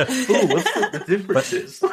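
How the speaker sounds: high, giggling question through laughter; laughing. Real, not acted. Host laughing as she asks about Welsh accents. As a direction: ask while still laughing. Laughing at South Wales joke.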